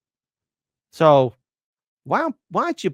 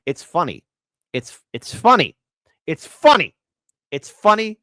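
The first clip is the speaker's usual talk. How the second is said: from plain to loud and higher; building mock insistence; 5 semitones higher, 7 dB louder than usual. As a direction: keep saying it louder each time. Repeating it's funny, building.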